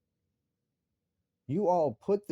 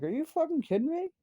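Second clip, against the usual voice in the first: much higher, outraged disbelief; incredulous. Retold or imitated. Father recalling his outburst at caseworkers' bribery, much higher incredulity. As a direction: squeal it in total disbelief. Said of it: "And I'm like".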